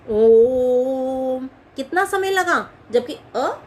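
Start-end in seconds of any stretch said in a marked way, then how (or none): 0.1-1.5 ओम held long and drawn out; prolonged Om. Shown as a demonstration. Teacher showing a pluta vowel, drawn-out ओम. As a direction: stretch ओम over three beats. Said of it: "उनका ओम".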